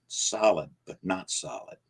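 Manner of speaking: plain reading voice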